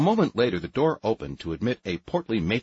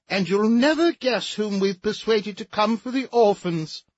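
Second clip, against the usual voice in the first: much higher, fluttery society matron; warbling high voice; 13 semitones higher, 4 dB louder than usual. Acted. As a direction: warble it an octave up. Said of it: "in a voice at least an octave above her natural range".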